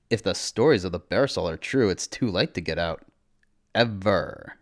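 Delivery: ordinary delivery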